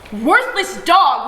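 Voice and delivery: loud, very slow spat insult; scream. A narrator's reading. Mother insulting drunk father, loud slow scream. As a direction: hurl the insult at him. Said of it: "Uma screams".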